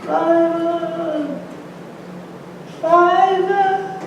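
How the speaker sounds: high, drawn-out faraway call of a lost little mouse; Fievel calling. Acted. Fievel calling out for his father across the city, high and long. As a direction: call into the distance for a missing father. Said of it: "he hears his name".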